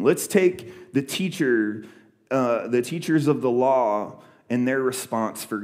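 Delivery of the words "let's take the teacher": normal talking voice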